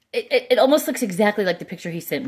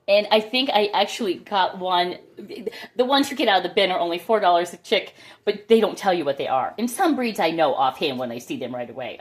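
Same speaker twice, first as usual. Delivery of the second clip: usual speaking voice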